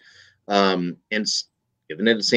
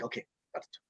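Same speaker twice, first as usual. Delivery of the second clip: whispered plan; 19 dB quieter, faster than usual. Retold or imitated. Priest hatches a plan.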